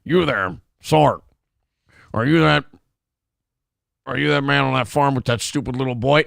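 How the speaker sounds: put-on halting, stiff-mouthed American accent; put-on American drawl. Actor retrying the line for director, clumsy American drawl. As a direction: force out an American accent through a mouthful of screws. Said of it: "I gotta talk like this, like I got screws in my mouth".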